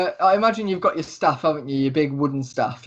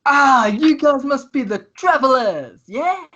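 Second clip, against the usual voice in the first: high, loud, drawn-out greeting; big, theatrical welcome from a showy rogue; Lucius, flamboyant tiefling thief; 9 semitones higher, 4 dB louder, slower than usual. Acted. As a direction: exclaim it grandly, lifting to a high questioning end. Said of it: "he gets up and goes".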